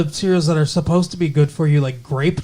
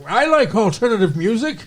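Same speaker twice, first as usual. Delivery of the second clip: Jack's voice; 7 semitones higher than usual. Retold or imitated. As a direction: give Jack a put-on high voice. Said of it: "Jack needs the voice".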